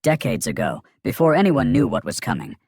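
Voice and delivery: plain reading voice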